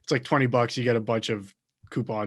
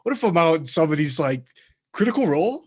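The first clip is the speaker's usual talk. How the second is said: ordinary talk, nothing marked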